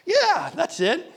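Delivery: put-on high, slow relieved old voice; a grateful old man finding his wallet. Old man thanks the nurse, high and slow with relief. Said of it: "he smiled with relief and he said".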